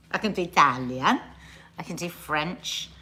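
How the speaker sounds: ordinary talk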